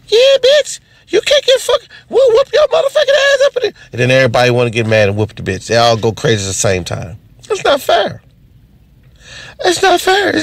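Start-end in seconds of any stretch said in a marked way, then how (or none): 0.1-3.7 aunt screaming. Acted. Aunt joining the fight at the restaurant, high shrieking. 7.5-10.4 very high squeaky whine; squealed complaint. Real, not acted. To the audience about people losing their minds, high whiny squeal.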